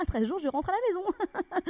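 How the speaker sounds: high, laughing voice. Real, not acted. Laughing at absurdity. (en riant, voix aiguë)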